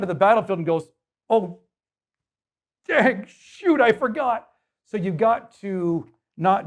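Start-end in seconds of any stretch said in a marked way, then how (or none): 1.3-4.5 higher, sudden oops; David caught without stones, hammed-up higher oops; comic dismay. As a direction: realize you forgot your stones.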